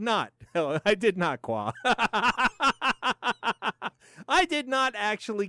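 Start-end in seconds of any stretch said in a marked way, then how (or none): 1.8-3.9 long staccato chuckle; steady run of quick ha-has; laugh. Real, not acted. Laughs at missing a comment.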